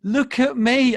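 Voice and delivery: loud, high appeal; urgent loving call. Acted. God the Father calling his child to look at him, urgent. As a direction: call out insistently for their eyes.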